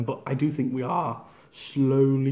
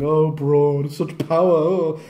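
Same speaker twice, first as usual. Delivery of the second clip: louder mock-impressed taunt; mocking laugh-off; 7 dB louder, much slower than usual. Bray mocking Braun while laughing off his offense, louder sarcastic taunt. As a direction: shrug off the hits with sarcasm.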